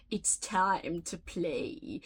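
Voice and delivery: low, slow, sinister doll voice; creepy doll, menacing and slow; too calm creepy doll. Pretend. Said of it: "say it in, like, a menacing, sinister, like, creepy doll voice".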